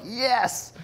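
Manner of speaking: mock-triumphant cheer, retelling himself; triumphant yes. Retells his confident moment.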